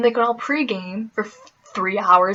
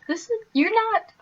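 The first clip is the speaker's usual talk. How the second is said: mock crying; 12 semitones higher than usual. Shocked at booze-carrying girls.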